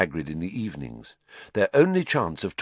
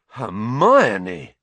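stunned; 2 semitones higher, 4 dB louder than usual. A narrator's reading. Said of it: "sounding both stunned and impressed".

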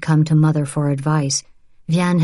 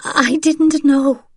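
slow, much higher, faltering denial; stammering; 11 semitones higher, 4 dB louder, slower than usual. Acted. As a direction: stumble over the words in panic. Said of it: "Vianne stammered".

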